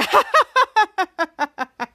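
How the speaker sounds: long, high, loud mock laugh; loud staccato cackle that trails downward; character's laugh. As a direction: laugh it out in bursts, high and hammed-up. Voices the character's laugh in retelling.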